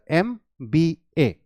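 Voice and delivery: "एम बी ए" spelt out slowly with gaps; three separate letter names, "एम बी ए". Shown as a demonstration. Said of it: "letter by letter". ("एम बी ए" एक-एक अक्षर अलग, धीरे)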